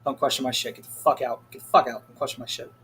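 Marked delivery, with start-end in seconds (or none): 1.5-2.1 louder second go at the same line; louder repeat. Shown as a demonstration. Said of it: "repeated louder". 2.1-2.7 hissed in a whisper; mock whisper. Shown as a demonstration. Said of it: "repeated as whisper".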